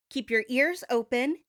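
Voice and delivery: normal talking voice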